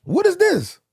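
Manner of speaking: high, bewildered shock. Retold or imitated. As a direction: act floored by the outside world. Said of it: "I'm like".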